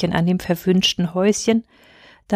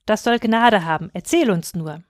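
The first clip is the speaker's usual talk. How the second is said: ordinary delivery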